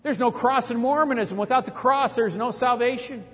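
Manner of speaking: high, slow, ringing street preaching; preaching the cross. Retold or imitated. Street preacher calling out to a crowd of missionaries, high and slow. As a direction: preach it out at full force, slowly. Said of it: "And I started preaching the cross".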